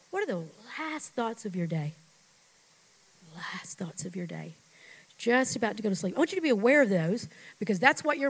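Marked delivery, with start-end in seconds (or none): 0.1-1.9 softened. Acted. Preacher slows for bedtime thoughts. 3.3-4.5 whisper. Shown as a demonstration. Echoes the line in a whisper.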